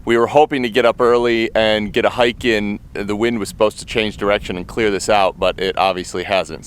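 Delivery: ordinary talk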